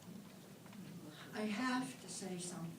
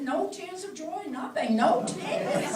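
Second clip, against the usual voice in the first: laughing quote; 9 semitones higher, 13 dB louder, slower than usual. Retold or imitated. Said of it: "And he said".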